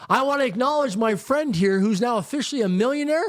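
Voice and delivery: high, carrying public announcement to the whole room. Retold or imitated. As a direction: announce it to the packed restaurant, slowing on flat broke. Mentor announces to packed restaurant.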